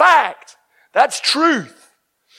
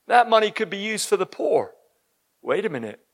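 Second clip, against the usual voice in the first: ordinary talk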